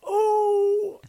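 very high loud surprised oh; surprised squeal. Real, not acted. Reacting to the co-host's weather pun with a high squeal.

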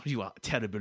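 put-on low French-accented reproach; disgusted, low French-Canadian scolding. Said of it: "when you said terrible the first time".

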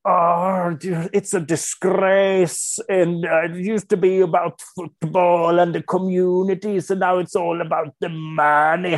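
put-on ranting old chairman. Imitating a club chairman's reaction to the Super League, mock outrage. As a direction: rant slowly like an outraged football veteran. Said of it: "Helt tokig. Han bara".

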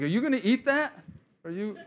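high, eager voice of a hungry husband; a hammed-up insensitive husband eyeing her plate; mock clueless husband. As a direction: mimic the husband angling for her food. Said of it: "Mister Sensitive here".

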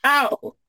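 high, mock-pained "ow"; sudden high cry; yelp of pain. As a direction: yelp as if poked in the face. Poked in the face.

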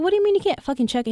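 high, rapid indignant outburst; retold pissed-off protest. Pissed traveler challenging the desk clerk, retold indignation. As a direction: fire it off, mock-outraged. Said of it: "like, fucking pissed".

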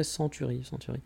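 English word in a rough French accent; quiet, poorly accented English; rotten accent. Shown as a demonstration. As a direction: mutter it softly with a bad French accent. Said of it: "un accent pourri".

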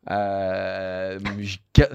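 hollow, cavernous sick voice; rough cavernous voice from a cold. Real, not acted. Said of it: "ça fait vraiment une voix caverneuse".

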